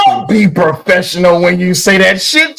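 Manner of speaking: high, loud, drawn-out heckle; loud teasing protest. Real, not acted. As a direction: call him out at the top of your voice. Heckles the guest's formal answer.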